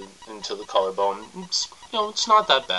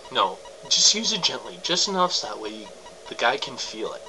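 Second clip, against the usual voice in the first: ordinary talk, nothing marked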